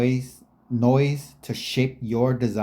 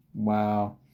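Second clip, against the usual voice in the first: normal talking voice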